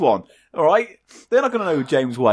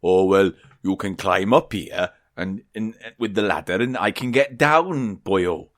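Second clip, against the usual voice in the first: lower, put-on Welsh whale voice; Welsh accent; 6 semitones lower than usual. Whale asking the duck for the ladder, Welsh accent. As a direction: speak low with a Welsh lilt. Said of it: "James the whale goes".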